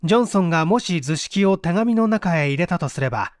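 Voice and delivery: usual speaking voice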